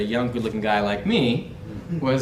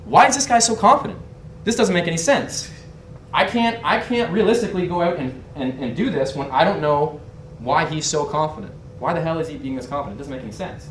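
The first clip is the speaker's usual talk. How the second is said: higher, exasperated puzzlement, easing off; voiced bafflement; 5 semitones higher, 3 dB louder than usual. Rival wondering why he's so confident, baffled and higher at first. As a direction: think aloud as the confounded rival.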